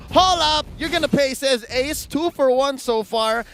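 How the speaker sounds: loud fast live hype call; excited play-by-play. Real, not acted. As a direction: ramp up the teamfight call.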